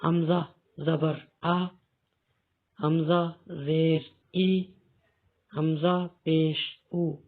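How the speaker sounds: slow, careful reading of each vowel; slow reading demo. Shown as a demonstration. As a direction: read each sound slowly for beginners. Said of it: "تو اب ہم اس کو پڑھیں گے". (آہستہ، صاف تلفظ کے ساتھ)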